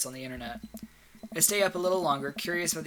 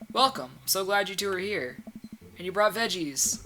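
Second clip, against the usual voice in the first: effusive, higher-pitched party host; welcoming host; 4 semitones higher than usual. Acted. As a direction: greet the new neighbors with open arms. Host greets guests with open arms.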